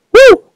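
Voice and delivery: very high, loud woo; celebratory whoop. Real, not acted. Gamer winning the level, high celebratory whoop. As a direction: whoop in piercing falsetto.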